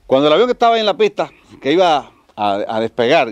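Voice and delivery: high, loud excited telling; excited boast. Acted. Passenger tells the steward about the engine, high loud excitement. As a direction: burst out proudly.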